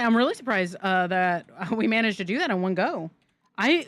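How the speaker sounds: normal talking voice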